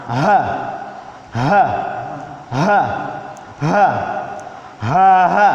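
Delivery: "Ha" pushed from inside the throat; "Ha" from deep in the throat, firm. Shown as a demonstration. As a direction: breathe "Ha" from deep down. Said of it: "Berasal dari dalam".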